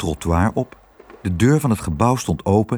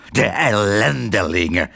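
growled fury at usual pitch; seething, snorting anger. Acted. Father raging after being dragged out, snorting anger. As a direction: spit it out like a fuming man. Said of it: "brieste Tos".

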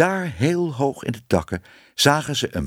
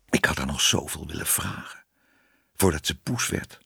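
soft, low inward musing; quietly wistful, to yourself; hushed regret; regretful thought; 6 semitones lower, 5 dB quieter than usual. A narrator's reading.

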